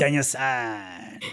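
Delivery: put-on slow, drawn-out Japanese accent; Miyagi. Host calling his student as the Karate Kid sensei, slow Japanese-accented impression. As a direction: stretch it out in a Japanese master's accent. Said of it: "I was doing an accent".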